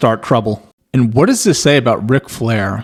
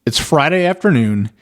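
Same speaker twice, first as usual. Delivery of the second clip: ordinary talk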